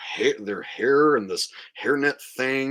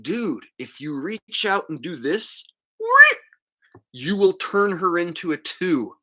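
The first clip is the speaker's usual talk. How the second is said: ordinary talk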